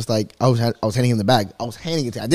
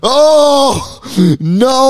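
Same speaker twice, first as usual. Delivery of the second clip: much higher, louder fake crying cry; hammed-up dismayed cry; mock wail; 12 semitones higher, 4 dB louder than usual. Reacts to Draco Malfoy comparison.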